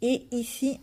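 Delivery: ordinary talk, nothing marked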